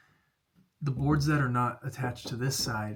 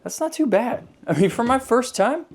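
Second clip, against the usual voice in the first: pleased; 12 semitones higher, 7 dB louder than usual. Real, not acted. Proud of first attempt.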